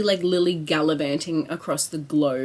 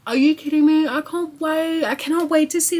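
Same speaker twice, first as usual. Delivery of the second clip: higher and louder, bursting with glee; excited outburst; 8 semitones higher, 4 dB louder than usual. Real, not acted. Vlogger exclaiming to viewers about her trip, thrilled.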